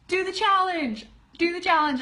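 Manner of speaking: high, loud, eager push to do the challenge; rally the group, bursting with excitement; cheerleading her students; excited urging. Real, not acted. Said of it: "excited urging to her group".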